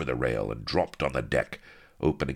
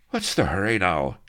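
higher-pitched worried query; wondering; 5 semitones higher, 4 dB louder than usual. A narrator's reading. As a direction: stall them with a question. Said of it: "he wondered".

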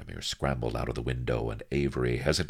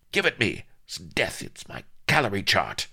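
irate demand; 6 semitones higher than usual. A narrator's reading. Mistress demands her chart, fingers snapping.

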